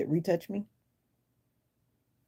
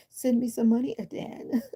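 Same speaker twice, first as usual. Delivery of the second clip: playful; 7 semitones higher than usual. Real, not acted. Teasing a commenter for money.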